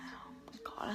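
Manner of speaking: breathless whispered panic; retold whisper. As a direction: hush it like a scared secret. Panic before the office.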